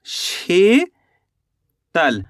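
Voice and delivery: Sheetal drawn out very slowly, शी stretched, then a pause before तल; syllable by syllable. Shown as a demonstration. Teacher sounding out the finished word for learners, Sheetal split slowly into syllables.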